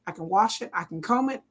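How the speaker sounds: usual speaking voice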